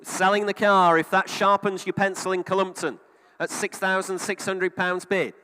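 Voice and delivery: loud auctioneer calling bids; auction bid spotter. Real, not acted.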